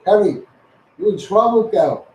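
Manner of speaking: normal talking voice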